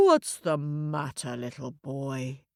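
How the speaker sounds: low cow voice, softening at the end; kindly cow. Acted. Cow asks the crying boy what's wrong, gently. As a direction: ask gently as a concerned cow. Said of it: "along came a cow".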